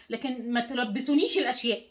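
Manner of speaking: ordinary delivery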